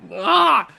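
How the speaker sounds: loud, high "ah!"; sudden high yell; excited yelp. Real, not acted. Player reacting to a big hit, loud yelp.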